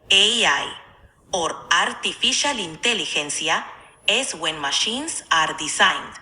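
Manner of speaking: put-on English with Spanish accent; Spanish-accented English explanation. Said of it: "speak in English only in Spanish accent".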